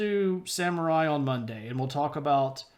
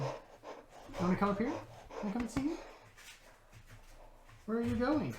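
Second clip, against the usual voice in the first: softer, higher coaxing voice; puppy talk; 7 semitones higher, 8 dB quieter than usual. Real, not acted. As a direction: coax a puppy over in a high little voice.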